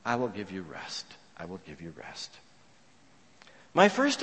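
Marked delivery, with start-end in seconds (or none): 0.1-2.3 low, hushed reassurance, fading softer; tender promise. Acted. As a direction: repeat it gently, sinking almost to nothing. Voicing Jesus's tender promise of rest.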